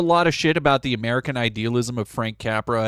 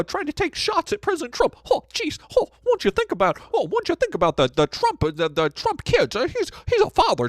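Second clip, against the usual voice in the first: much higher, whining mock voice of a Trump defender; whiny MAGA mock; 11 semitones higher, 4 dB louder than usual. Streamer parodying Trump supporters, in a much higher whine. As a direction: squeal it out like a pearl-clutching Trump fan. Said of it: "people are like".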